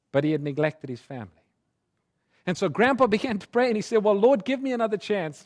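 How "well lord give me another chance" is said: begging prayer. Retold or imitated. Grandpa begs for another chance.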